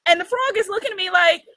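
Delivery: ordinary talk, nothing marked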